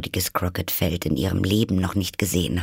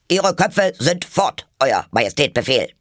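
shouted answer; 5 semitones higher, 4 dB louder than usual. Acted. Soldiers report to the Queen, shouting. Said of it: "schrien die Soldaten als Antwort".

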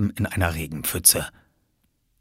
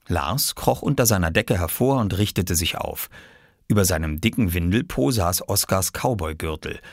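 plain reading voice

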